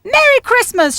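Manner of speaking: high, bright call of greeting; cheerful shout. A narrator's reading. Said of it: "shouted Grandpa and Grandma Speckles".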